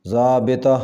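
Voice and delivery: ضابطہ in three syllables, "zaabita"; correct pronunciation. Shown as a demonstration. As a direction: say ضابطہ as zaa-BI-ta. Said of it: "صحیح تلفظ ہوگا".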